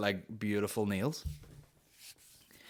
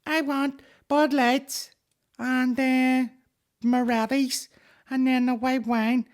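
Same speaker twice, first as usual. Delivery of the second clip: put-on very high, loud, slow child voice; hammed-up little kid shopping order; eight-year-old child; 5 dB louder, slower than usual. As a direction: drag out each item like a demanding child. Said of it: "An alcoholic eight-year-old?".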